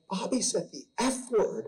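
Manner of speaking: high-pitched imitation of a child; upset little girl. Daughter telling dad about imaginary friend, upset child voice. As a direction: play a small daughter tattling, upset. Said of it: "she came to me crying".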